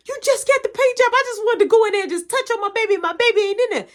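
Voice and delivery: high, loud, fast frantic wail; a mother whose car was stolen, frantic; panicking mom. Retold or imitated. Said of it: "She flipping".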